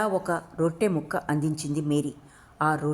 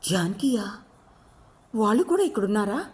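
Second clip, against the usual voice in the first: fearful; 3 semitones higher than usual. A narrator's reading. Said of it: "భయం భయంగా అడిగింది".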